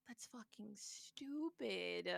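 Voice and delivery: slow, exasperated whisper; retold reaction hissed under the breath; whispered disgust. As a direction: whisper it slowly, fed up. Retells muttered reaction to corporate excuse.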